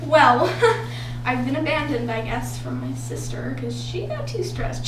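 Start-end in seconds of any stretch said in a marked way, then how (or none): none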